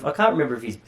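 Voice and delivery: normal talking voice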